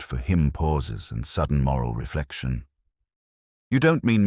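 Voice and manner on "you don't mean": high and louder, catching breath; a shocked, faltering gasp of a question. A narrator's reading. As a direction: break off, startled and unsure. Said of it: "Miss Carter faltered".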